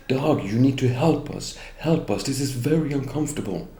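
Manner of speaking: pleading call for help. Retold or imitated. Women from the bookstore begging Doug for help, high pleading call.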